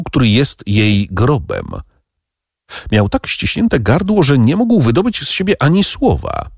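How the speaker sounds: ordinary delivery, nothing marked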